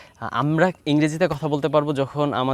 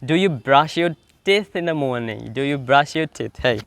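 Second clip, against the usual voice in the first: slow drill questions; much slower than usual. Shown as a demonstration. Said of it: "কথা বলতে হবে".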